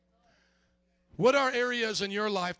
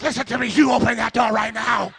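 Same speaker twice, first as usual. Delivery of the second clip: loud, forceful order; commanding shout; 10 dB louder than usual. Retold or imitated. Pastor ordering a man to open the bathroom door, commanding shout. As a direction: shout it through the stall door, out of patience.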